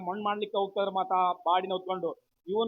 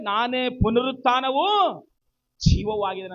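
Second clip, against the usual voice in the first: ringing proclamation; 4 semitones higher, 8 dB louder than usual. Acted. Preacher proclaims Jesus' own words. (ಜೋರಾಗಿ, ನಿಧಾನವಾಗಿ ಘೋಷಿಸುವ ಧ್ವನಿ)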